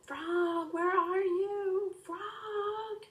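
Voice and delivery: high, drawn-out yell; calling out. Acted. Boy at the open window yelling for his lost frog, long high call. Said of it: "and yelled".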